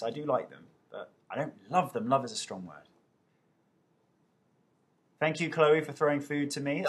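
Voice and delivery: normal talking voice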